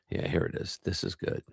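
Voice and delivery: usual speaking voice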